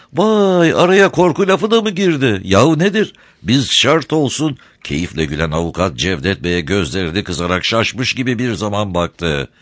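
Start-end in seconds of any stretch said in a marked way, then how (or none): 0.1-4.6 high, sweet-talking crafty smuggler; sly, sugary old rogue; honeyed voice. A narrator's reading. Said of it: "tatlı konuşuyor".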